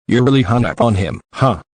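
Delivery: ordinary delivery, nothing marked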